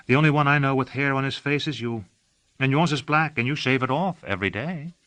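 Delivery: gently teasing her companion, lightly voiced; a hint of playful Delilah; Delilah's voice. A narrator's reading.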